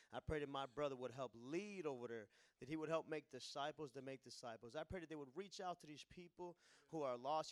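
normal talking voice

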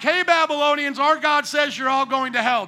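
hammed-up hellfire call-out; mock condemnation. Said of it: "They were not like".

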